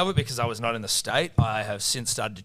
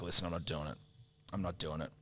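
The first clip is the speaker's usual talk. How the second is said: quiet refusal; 6 semitones lower, 12 dB quieter, faster than usual. Retold or imitated. Refusing the chore.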